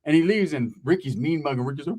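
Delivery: ordinary talk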